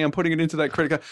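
ordinary delivery

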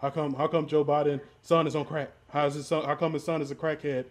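usual speaking voice

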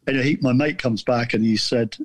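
usual speaking voice

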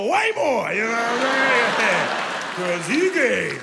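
building punchline. Real, not acted. Punchline reveal.

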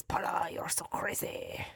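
put-on hushed French-accented whisper; softly breathed French movie line; imitated French accent. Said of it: "and so she always says".

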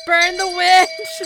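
high, loud, slow mob shout; angry mob leader. Acted. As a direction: whip up the mob. Mock mob chant.

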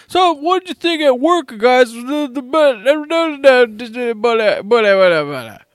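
high, stuttering mock Howard. Mimicking the friend's garbled chat in a high stammer.

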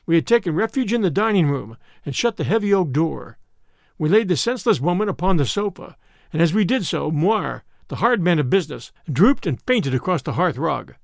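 ordinary delivery, nothing marked

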